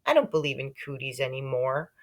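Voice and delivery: ordinary delivery, nothing marked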